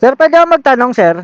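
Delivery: normal talking voice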